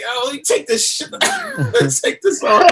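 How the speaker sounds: high, laughing, caught off guard; flustered laughing. Retold or imitated. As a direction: giggle while scrambling to take it off. Flustered on video call, laughing.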